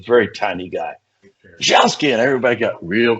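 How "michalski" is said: loud high call again; yelled name. Retold or imitated. Captain calls his officer once more, loud call.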